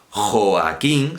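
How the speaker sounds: "Joaquín" slow, with o and a as separate vowels; separate syllables. Shown as a demonstration. Teacher showing a hiatus, saying Joaquín slowly in full syllables. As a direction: stretch "Joaquín" into Jo-a-quín. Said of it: "las sílabas Jo".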